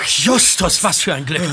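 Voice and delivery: usual speaking voice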